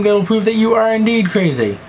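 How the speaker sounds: ordinary talk, nothing marked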